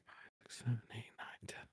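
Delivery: whispered counting under the breath; whispering. Real, not acted. Counting entries under his breath.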